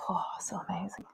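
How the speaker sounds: a breathy whisper of delight. Real, not acted. Teacher savouring her aromatherapy, whispered delight. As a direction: whisper it as if lost in the scent.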